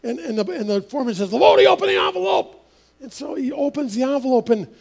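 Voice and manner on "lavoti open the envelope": foreman's order. Retold or imitated. Foreman orders Mike in office.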